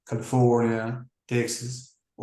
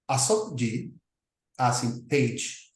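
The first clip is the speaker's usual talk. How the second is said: normal talking voice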